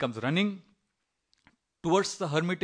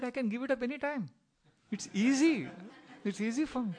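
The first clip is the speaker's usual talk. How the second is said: higher-pitched, breezy insistence; breezy denial; 9 semitones higher, 3 dB quieter than usual. Retold or imitated. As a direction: brush it off as easily done, pitched up. Friend breezily denying his smoking addiction.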